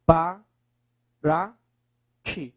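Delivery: para and ti said apart with a long pause; split into syllables. Shown as a demonstration. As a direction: break para ti into separate pieces. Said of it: "Separe pra mim, por favor".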